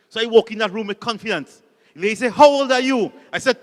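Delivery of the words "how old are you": louder, higher barked question; loud demand. Retold or imitated. Lady interviewer barking at a nervous job applicant, loud. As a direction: boom it out like a stern interviewer.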